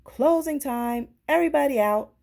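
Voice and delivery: loud closing-time call. Acted. Manager calling customers out at closing, loud. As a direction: shout it across the laundromat. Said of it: "the manager of the laundromat called".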